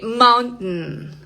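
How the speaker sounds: "mountain" with a stopped T and nasal n; glottal T. Shown as a demonstration. Teacher giving another example word, glottal T. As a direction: cut "mountain" in the throat, release through the nose. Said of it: "Esa es una T glotal".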